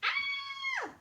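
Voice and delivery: very high, loud squeal of fright; shrill squeal. A narrator's reading. Queen squealing at the rat in her soup. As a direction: squeal like you've seen a rat. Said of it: "squealed the queen".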